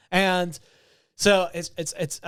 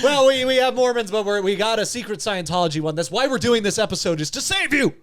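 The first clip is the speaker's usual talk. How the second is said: excited; 5 semitones higher, 4 dB louder than usual. Real, not acted. Joking about saving viewers.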